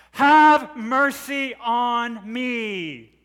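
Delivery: long, high, loud cry for mercy; desperate shout. Acted. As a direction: shout it slowly with a drawn-out plea. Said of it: "she shouts again".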